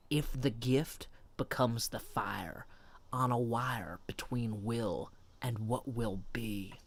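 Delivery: low, hushed recital of song lyrics; dramatic lyric reading. Acted. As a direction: recite it softly like solemn poetry, slowing toward the end.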